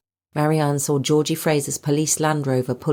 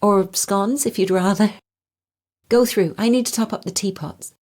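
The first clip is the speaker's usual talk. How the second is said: playacting hostess; 6 semitones higher than usual. A narrator's reading. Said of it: "feeling as though she was acting in a play".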